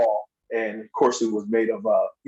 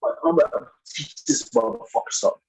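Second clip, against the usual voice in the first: high, breathless whisper; whispered threat; 10 semitones higher than usual. Retold or imitated. New Jack's threat quoted.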